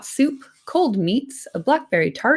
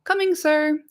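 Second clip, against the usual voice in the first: eager servant; 9 semitones higher than usual. Acted. Nob answers call.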